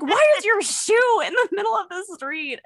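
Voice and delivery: high incredulous mock question; imitating Jordan. Friend on Snapchat spotting the shoe, high mock disbelief. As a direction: act out the friend's baffled reaction, rising. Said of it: "he's like".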